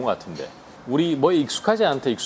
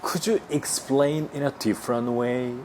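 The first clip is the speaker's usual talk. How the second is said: very slow, every word spaced; teacher modelling slowly; slow demo; much slower than usual. Shown as a demonstration. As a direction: spell it out for learners. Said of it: "이런 식으로 하시면 돼".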